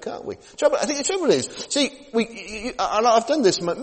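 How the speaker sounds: usual speaking voice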